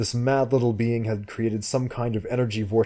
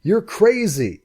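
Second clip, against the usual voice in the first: slow, much higher, louder shout; angry shout; 12 semitones higher, 6 dB louder, slower than usual. Acted. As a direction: fling it at her in exasperation. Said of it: "he shouted".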